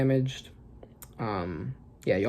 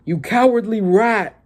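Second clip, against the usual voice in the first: slow, higher, louder scorn; contemptuous insult; 6 semitones higher, 5 dB louder than usual. Acted. Rox sneering at King Harold, slow angry scorn. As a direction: spit it out at a coward.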